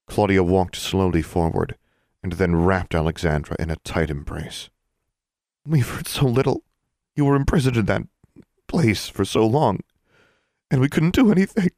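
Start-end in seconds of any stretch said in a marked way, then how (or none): 5.6-11.7 tearful, rising high and strained; crying. A narrator's reading. Claudia to Alexandra after months apart, tearful and high. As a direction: voice cracking with tears, pleading. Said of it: "Claudia was crying".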